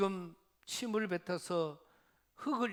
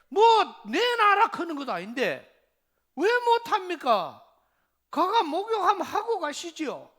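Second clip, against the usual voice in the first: loud, urgent, higher pleading; pleading servant; 9 semitones higher, 12 dB louder than usual. Retold or imitated. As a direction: beg the general loudly to just try it. Servant urges Naaman to bathe.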